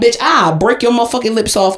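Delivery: usual speaking voice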